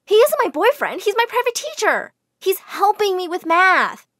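high, loud, pleading denial; high protest. A narrator's reading. Jane protesting to the widow, high and loud.

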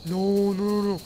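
ordinary talk

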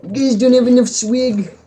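mocking commenter voice. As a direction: put on a silly jeering commenter. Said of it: "He's gonna leave comments and be like".

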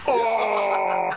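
loud, high burst of delight; excited shout. Real, not acted. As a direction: yell out at the big hit. Big in-game hit lands.

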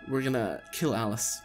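ordinary talk, nothing marked

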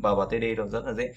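ordinary talk, nothing marked

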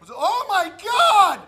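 high, slow horrified exclamation; drawn-out shocked cry; shocked gasp. Acted. Sees spike in friend's head.

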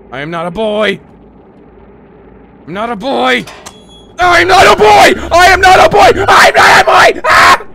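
from firm to high screamed insistence; building defiant scream; building to a shout. Real, not acted. Psyching himself up, escalating.

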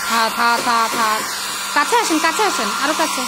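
loud over a hair dryer; talking over noise. Real, not acted. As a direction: raise your voice over the blower.

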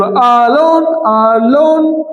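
"alone" stretched into a... lone; divided into syllables. Shown as a demonstration. Teacher dividing the word for the class, "alone" split as a-lone. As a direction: separate the two syllables of "alone". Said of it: "syllable divide করবো".

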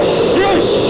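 high, forceful acted shush; commanding shush. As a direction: shush a roaring storm. Jesus silences the storm.

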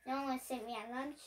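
ordinary delivery, nothing marked

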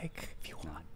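unvoiced hushed aside; whisper. Real, not acted. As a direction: whisper it off mic. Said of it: "totally acceptable microphone whisper".

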